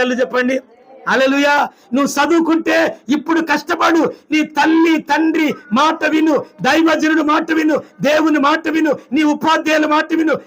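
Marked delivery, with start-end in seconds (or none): 1.9-10.3 fiery exhortation. Acted. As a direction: drive each command home to the children. (పిల్లలకు బిగ్గరగా హెచ్చరిక)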